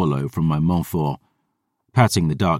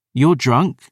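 higher, teasing reproach; playful half-hearted scolding; 8 semitones higher, 3 dB louder than usual. Acted. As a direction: scold him, but only halfway. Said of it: "only half scolding".

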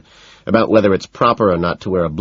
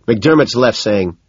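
ordinary delivery, nothing marked

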